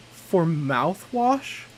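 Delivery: put-on slow, drawn-out "For mouthwash?" in a southern accent; southern accent, slow and incredulous. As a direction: stretch out "For mouthwash?" slowly with a southern lilt. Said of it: "I keep forgetting how to do a southern accent".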